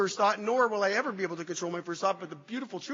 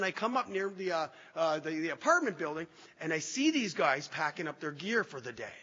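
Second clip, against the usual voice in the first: ordinary talk, nothing marked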